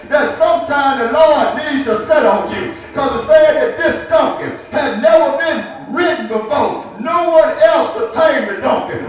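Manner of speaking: ordinary delivery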